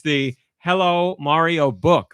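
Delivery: slow, drawn-out product read; mock ad read. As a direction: present it like a kids' advert. Presenting baby book like advert.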